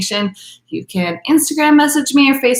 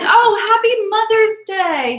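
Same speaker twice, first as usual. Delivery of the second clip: excited greeting; 12 semitones higher, 3 dB louder than usual. Real, not acted. Greeting mom on camera.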